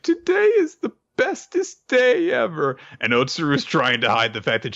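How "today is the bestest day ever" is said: put-on very high, sing-song child voice; happy little girl. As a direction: beam like a kid on her best day. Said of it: "she's like".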